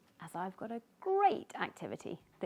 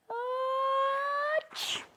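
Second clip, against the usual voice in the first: high loud achoo cutting off the words; sudden sneeze; 5 dB louder than usual. Acted. Sneezy sneezing mid-boast, loud high. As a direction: explode into a sneeze.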